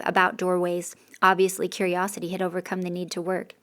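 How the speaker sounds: usual speaking voice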